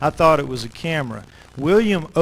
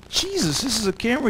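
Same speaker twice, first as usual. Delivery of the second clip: much higher, breathy amazement; amazed; 7 semitones higher, 4 dB quieter, faster than usual. Retold or imitated. Narrator sees the grenade-launcher camera, amazed and high. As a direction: gasp it out in disbelief.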